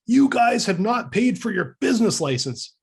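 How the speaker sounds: mock-indignant accusation, pitched high; imitating steamed old man. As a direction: scold them like a fuming city official. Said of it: "steamed, and he was an old guy".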